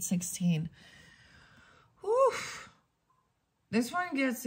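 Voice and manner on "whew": whew. Real, not acted. Blowing out after a heavy reading.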